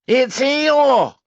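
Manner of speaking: very high, loud cry; terribly excited. A narrator's reading. Roo spotting Eeyore in the river, excited cry. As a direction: yell it like an overjoyed child. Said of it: "cried Roo, terribly excited".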